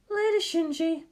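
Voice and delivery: high, lively woman's voice; bright teasing guardian; Misato voice. Acted. Misato questions Shinji at the target, high lively voice.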